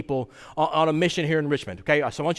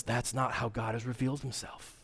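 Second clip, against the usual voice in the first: hushed quote; 8 semitones lower, 13 dB quieter than usual. Retold or imitated. Quoting Micah quietly.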